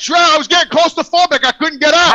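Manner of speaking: loud, worked-up insistence; loud protest. Real, not acted. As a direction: raise your voice to be believed over the others. Insisting over friends he was trapped.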